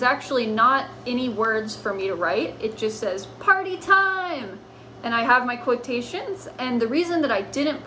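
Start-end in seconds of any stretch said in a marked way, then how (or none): 3.4-4.6 high, slow, drawn-out "Party time!"; excited cheer. Acted. Teacher voicing cats celebrating, high and drawn out.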